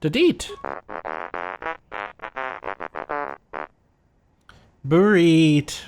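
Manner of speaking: spoken robot beeps; droid beeps. Pretend. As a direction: chirp like a little droid. Player voices droid beeps.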